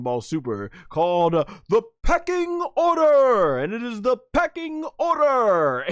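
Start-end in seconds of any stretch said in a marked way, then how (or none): 1.7-3.6 big hammed-up title call; mock announcer cry. Announces his running joke's title. 4.0-5.9 hammed-up proclamation; mock announcer cry. Repeats his running joke's title.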